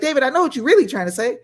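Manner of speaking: quick, high-pitched imitated accuser; mock accusation. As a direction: rattle it off as a knowing scolder. Acts out people accusing him.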